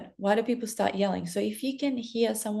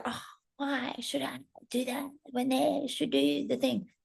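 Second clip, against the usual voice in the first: higher, softer put-on grumbling; mock reluctant complaint; 5 semitones higher, 5 dB quieter than usual. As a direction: play someone resisting, asking why it's on them. Mimics resentful objection to mirroring.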